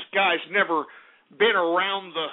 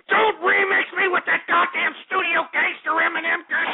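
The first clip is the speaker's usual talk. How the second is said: angry outburst; 7 semitones higher than usual. Acted. Host furious about the remix.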